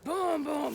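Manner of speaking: giant footsteps. Acted. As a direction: stomp out the troll's footfalls. Giant troll footsteps approaching.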